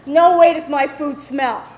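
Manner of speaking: loud, slow indignant comeback; feisty child. Retold or imitated. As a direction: stand up for yourself, drawing it out loudly. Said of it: "I was kind of a feisty child".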